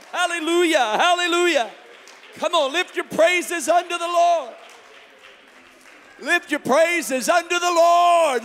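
loud, high revival shout; full-force preaching. Real, not acted. Preacher urging the congregation to lift praises, full-force shouted preaching. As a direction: rouse the room to praise at full volume.

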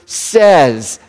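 "says" leaned on and drawn out; stress on says. Shown as a demonstration. Preacher underlining "says" for the congregation, stressed. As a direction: hit the word "says". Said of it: "I would underline the word".

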